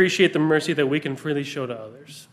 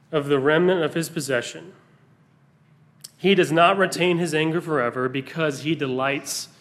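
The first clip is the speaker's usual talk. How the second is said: scripture reading. Acted. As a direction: read it out deliberately to the congregation. Pastor reads Micah aloud.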